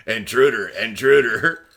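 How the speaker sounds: mock robot alert; robot alarm. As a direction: blare it like a robot siren. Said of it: "eighties alert robot thing".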